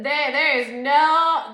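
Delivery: loud, slow and emphatic; emphatic drawn out. Real, not acted. Ranting about being put in a room with her exes, loud and slow. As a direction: lean hard on every word, stretching it out.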